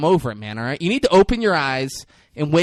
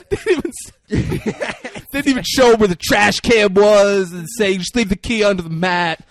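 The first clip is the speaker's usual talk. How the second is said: derisive ridicule; 4 semitones higher, 6 dB louder than usual. Real, not acted. Ridiculing Chucky for leaving his house to them, loud derision. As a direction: pile on the ridicule, loudly. Ridiculing the character's weak exit.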